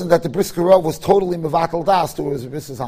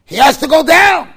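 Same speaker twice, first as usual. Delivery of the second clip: firm insistence; 9 semitones higher, 11 dB louder than usual. Retold or imitated. Rabbi insisting he must go.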